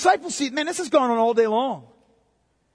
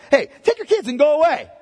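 louder, higher brush-off; shooing disciple; 5 semitones higher, 6 dB louder than usual. Retold or imitated. Disciples sending parents and kids away, brusque. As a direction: shoo the families off. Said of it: "telling people to go away".

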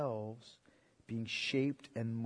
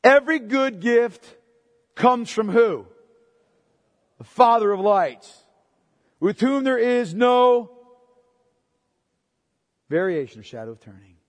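proclaiming scripture; 9 semitones higher, 14 dB louder than usual. Acted. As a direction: lift the verse up and declare it. Preaching at full force.

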